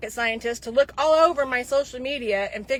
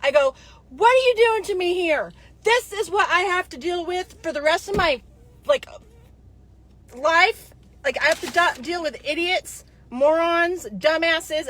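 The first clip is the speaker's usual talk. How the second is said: higher, exasperated mock holler; retold yelling; 5 semitones higher, 4 dB louder than usual. Yelling at husband Zach about dealing with idiots, mock holler. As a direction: vent at him like you're fed up. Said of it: "screaming and hollering at my husband".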